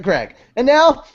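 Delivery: ordinary delivery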